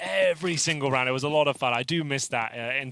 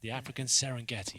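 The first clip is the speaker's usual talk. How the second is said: nature documentary; 2 semitones lower, 6 dB quieter than usual. Pretend. Joking nature documentary bit.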